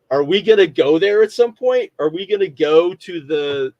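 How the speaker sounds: high, loud voice of dawning anticipation; excited wondering. Retold or imitated. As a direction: pitch it up as an eager question. Acts out his excited reaction.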